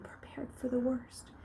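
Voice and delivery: breathy tense whisper. A narrator's reading. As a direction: hush it out, tense.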